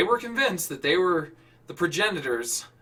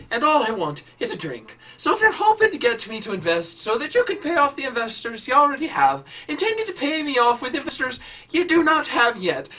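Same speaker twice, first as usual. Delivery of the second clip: rising, louder exasperated Irish voice; angry Irish rant; 8 semitones higher, 5 dB louder than usual. Acted. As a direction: build from tired to fed up. Irritated Irishman dismisses them.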